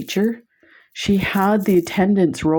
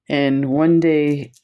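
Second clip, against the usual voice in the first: ordinary talk, nothing marked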